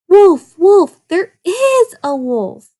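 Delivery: high loud panicked call of wolf; crying out. A narrator's reading. Boy calls out as the wolf approaches, loud panicked shout. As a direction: scream it while scrambling up the tree. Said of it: "the boy cried as he scrambled up a tree".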